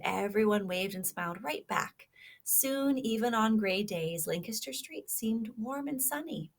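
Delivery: lively storybook read-aloud; reading to children. Acted. Teacher reading a kindness story to children, animated. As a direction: read it like a picture book to little listeners.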